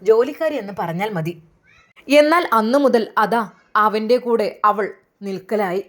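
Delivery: usual speaking voice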